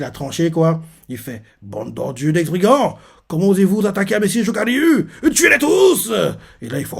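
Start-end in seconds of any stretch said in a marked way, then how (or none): 1.6-6.1 monte de plus en plus haut jusqu'au cri final; un lieutenant furieux qui ordonne le massacre; cri de rage. Retold or imitated. (cri de colère imité, de plus en plus aigu)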